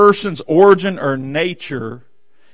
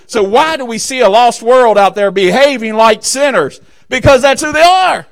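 rising preaching climax; 5 semitones higher, 4 dB louder than usual. Real, not acted.

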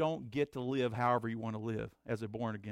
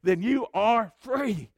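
raised, high preacher's call; fervent preaching; 8 semitones higher, 8 dB louder than usual. Acted. Pastor telling believers they are free, fervent. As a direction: press it home to the church.

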